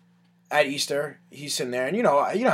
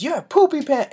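high, louder kiddie voice; gleeful little kid; 12 semitones higher, 5 dB louder than usual. Retold or imitated. Little boy answering his mom, gleeful kid voice. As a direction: imitate a kid proudly blurting it out.

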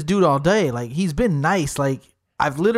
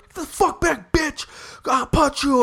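high-pitched hammed-up aggression; mock threatening yell; 10 semitones higher than usual. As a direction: exaggerate a barroom threat, voice pushed way up. Acts out threatening the drunk.

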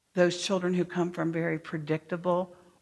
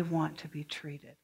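whispered point; 4 semitones lower, 8 dB quieter than usual. Real, not acted.

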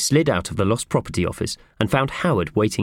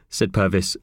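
ordinary delivery

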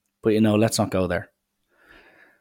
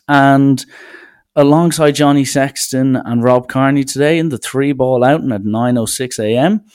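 louder, a touch of first-tee announcer; mock tour announcer; 4 semitones higher, 6 dB louder than usual. Pretending Rory McIlroy is teeing off at a charity outing, mock announcer. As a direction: lightly call out the pairing like a tee-time announcer.

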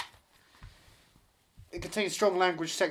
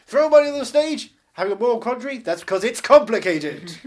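loud compère, starting high; mock stage announcer; 4 semitones higher, 11 dB louder than usual. Host urges crowd at Meryl Streep's act, mock announcer. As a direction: call out to the crowd with hammed-up showmanship. Announcer bit, film-title puns.

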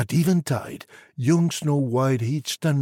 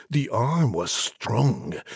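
ordinary delivery

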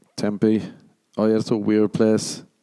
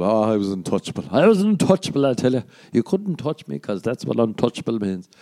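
mock boast. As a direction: gloat like a playground king. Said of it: "boasting about school".

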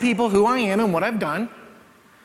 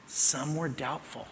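hushed repeat; 10 dB quieter, slower than usual. Acted. Preacher echoing the line softly to the congregation, hushed. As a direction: drop to a soft, slow echo.

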